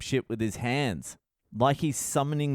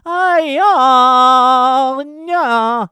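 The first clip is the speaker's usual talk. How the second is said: high, loud, strained wail held long; mock wailing singer noise; 12 semitones higher, 14 dB louder, much slower than usual. Comic mimicking the singer's opening wail. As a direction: belt out a pretentious wordless wail. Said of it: "he makes this type of noise".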